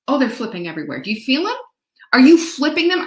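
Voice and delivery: normal talking voice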